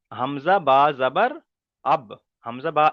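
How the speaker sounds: হামজা বা জাবার slow, the বা bounced; qalqala reading. Shown as a demonstration. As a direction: read হামজা বা জাবার slowly with a jolt on বা. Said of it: "কলকলা করে পড়তে হবে".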